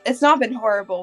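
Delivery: ordinary talk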